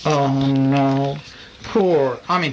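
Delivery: ordinary delivery, nothing marked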